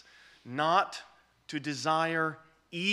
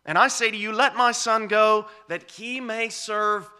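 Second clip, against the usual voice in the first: loud, high, slow promise from God; booming preacher voicing the Lord; preaching proclamation; 9 semitones higher, 8 dB louder than usual. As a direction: ring out God's promise to the hall. Preaching at full force.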